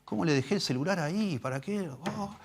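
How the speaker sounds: high, whiny mock lament over getting caught; caught sinner's self-reproach. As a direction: whine at your own carelessness.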